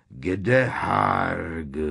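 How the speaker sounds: drawn-out, booming question from a giant; thundering giant. A narrator's reading. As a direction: boom it out in simple, heavy words. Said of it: "hřímal dráb".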